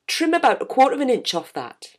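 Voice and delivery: imitated Geordie quip, pitched high; terrible Geordie accent. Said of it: "Apologies now for my terrible Geordie accent".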